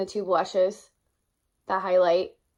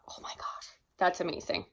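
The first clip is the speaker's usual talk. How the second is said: whispered thought; 2 semitones lower, 11 dB quieter than usual. Retold or imitated. Retold inner thought.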